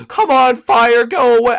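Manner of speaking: high, loud urgent shout at the fire; frantic loud plea; urgent yell. Real, not acted. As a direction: yell desperately for it to stop. Burning, urging fire out.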